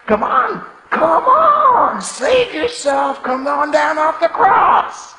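loud, high jeering "come on"; taunting shout. Retold or imitated. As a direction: dare him, mocking, at full voice. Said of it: "shouting abuse".

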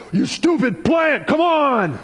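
exasperated high-pitched yell at a plant; comically impatient shout, drawn out; mock yelling. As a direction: yell in frustration, hammed up. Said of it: "You can yell at it".

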